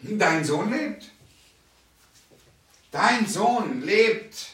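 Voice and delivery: slow, rising, weighted words; slow emphasis. Shown as a demonstration. Preacher repeating the healing word slowly with emphasis. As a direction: let each word land. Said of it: "repeated proclamation".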